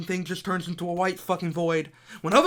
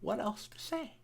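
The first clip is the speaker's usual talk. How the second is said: defeated; 2 semitones lower, 6 dB quieter than usual. Real, not acted. Deflated after the rant.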